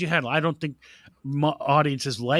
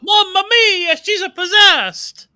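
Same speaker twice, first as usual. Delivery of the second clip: high, loud hammed-up Italian exclamation; mock Italian accent; 5 dB louder, slower than usual. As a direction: shout it like a cartoon Italian priest. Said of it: "Russell Crowe nails the accent".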